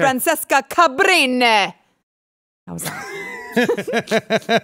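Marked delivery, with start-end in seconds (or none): 0.0-1.7 slow, drawn-out Italian accent on the name; a hammed-up Italian accent, higher and louder. Shown as a demonstration. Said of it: "judge my Italian accent".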